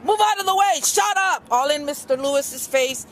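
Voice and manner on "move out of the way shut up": high, raised mock yell; imitating a shouting officer; retold yell. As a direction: snap it out like an angry cop. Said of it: "started screaming, yelling".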